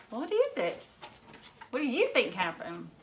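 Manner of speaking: ordinary delivery, nothing marked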